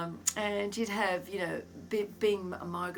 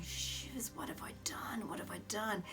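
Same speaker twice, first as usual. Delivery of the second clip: whispered, softer dismay; panicked under the breath; worried whisper; 3 semitones higher, 9 dB quieter than usual. Retold or imitated. Dad scolded in church, whispering his worry. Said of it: "Dad's going".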